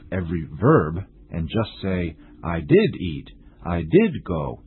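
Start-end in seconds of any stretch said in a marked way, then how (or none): 2.4-3.3 "did" punched before "eat"; stress on did. Shown as a demonstration. Teacher warning learners against overusing emphasis, stress on "did". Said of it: "a reason for stressing this". 3.7-4.6 "did" punched before "go"; stress on did. Shown as a demonstration. Teacher warning learners against overusing emphasis, stress on "did". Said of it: "a reason for stressing this".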